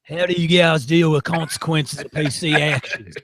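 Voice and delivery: broad, hammed-up Australian accent; badly faked Aussie that sounds like a drunk farmer. Host reading out a listener question in a bad Australian accent. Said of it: "Do a bad Australian accent".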